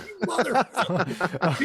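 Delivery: slow, drawn-out imitated curse; mock exasperation. Agent realising the rookie was kidding him, exasperated. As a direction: stretch the curse in mock outrage. Agent reacts to being kidded.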